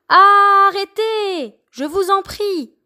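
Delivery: high, slow begging cry; pleading A. Acted. Letter A begging the fighting letters to stop. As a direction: beg them to stop, drawn out. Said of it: "A supplia".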